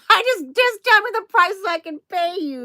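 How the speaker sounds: high-pitched plea through laughter; laughing mock plea. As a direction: laugh while acting out being fed up. Exasperated quote told while laughing.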